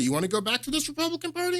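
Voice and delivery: high, fast, soft disbelieving question; incredulous. Real, not acted. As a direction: toss the question back in sheer disbelief. Incredulous retort to the claim.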